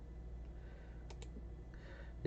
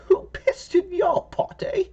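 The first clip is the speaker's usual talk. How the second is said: put-on cranky old lady; 4 dB louder than usual. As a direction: snap back like a cranky granny. Old lady snaps back.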